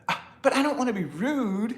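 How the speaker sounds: high, lightly whined worry; a touch of teen whine; daughter's whiny reply. Retold or imitated. Daughter fretting about rudeness, lightly whiny. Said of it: "daughter's reply".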